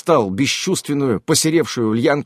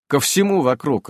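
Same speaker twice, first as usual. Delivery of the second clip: ordinary delivery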